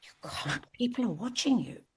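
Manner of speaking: put-on hushed, whispered Holland Park accent; best Holland Park accent. Mary reproving the singer over the guitarist, whispered Holland Park accent. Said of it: "in her best Holland Park accent".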